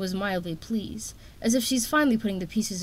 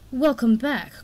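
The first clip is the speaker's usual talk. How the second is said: louder, menacing snarl; 4 semitones higher, 6 dB louder, slower than usual. A narrator's reading. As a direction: spit the greeting out coldly. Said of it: "Coral snarled at him".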